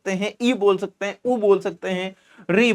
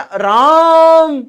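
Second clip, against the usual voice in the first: राम stretched very slow, high and loud; drawn-out shouting call; long called Ram; 9 semitones higher, 8 dB louder, much slower than usual. Shown as a demonstration. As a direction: call राम across a field. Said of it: "खींच के बोल रहे हैं".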